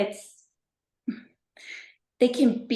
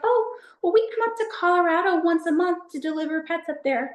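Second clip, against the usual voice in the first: mock cheery staff voice; 8 semitones higher than usual. Retold adoption staff reply.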